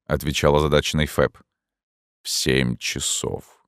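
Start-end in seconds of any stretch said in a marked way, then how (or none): 2.2-3.6 low, soft, very slow; hollow words from the dark; ghostly voice. A narrator's reading. Ghost speaks. (замогильный голос)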